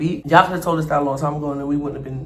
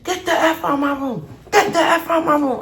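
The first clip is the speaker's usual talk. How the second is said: high, raised angry order; angry yell; 11 semitones higher than usual. Retold or imitated. Melissa yelling at the landlord in her room. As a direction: order the intruder out. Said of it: "I hear Melissa".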